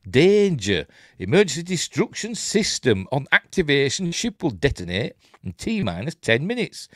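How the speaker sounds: loud, emphatic reading of a danger notice; punchy, declamatory safety announcement; warning read-out. Acted. Reads on-screen warning aloud.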